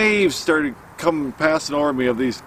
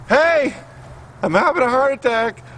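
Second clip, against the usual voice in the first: alarmed shout, pitched well up; calling out for help; 11 semitones higher than usual. Retold or imitated. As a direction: yell for the nurses to come back. Calling out to absent staff.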